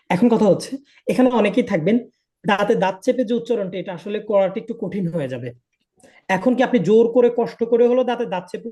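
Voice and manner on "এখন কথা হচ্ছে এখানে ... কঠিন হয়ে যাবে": normal talking voice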